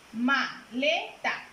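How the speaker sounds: "Maleta" said very slowly, beat by beat as ma-le-ta; slow syllable split. Shown as a demonstration. Teacher showing students the syllables of "Maleta", slow and spaced. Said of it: "¿Cuántos golpes de voz hay?".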